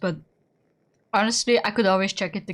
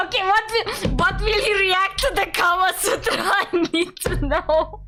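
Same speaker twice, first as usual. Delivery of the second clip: higher, giddy and bursting to laugh; giddy excitement; 8 semitones higher than usual. Real, not acted. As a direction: burst out with giggly anticipation. Laughing excitedly at her game choice.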